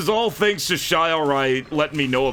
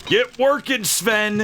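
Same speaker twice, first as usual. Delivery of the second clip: high-pitched, playful order to work; mock bossy shout; 8 semitones higher, slower than usual. Streamer ordering game character Sven to work, mock bossy shout. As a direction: call it out like an impatient foreman.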